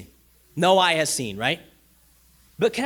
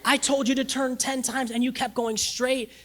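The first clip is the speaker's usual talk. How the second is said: exasperated disbelief; 6 semitones higher than usual. Retold or imitated. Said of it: "And God's like".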